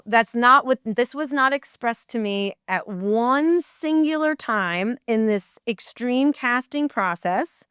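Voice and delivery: slow, emphatic retold protest; firm, deliberate objection; indignant refusal. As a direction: weigh each word as you refuse outright. Refuses the topless role.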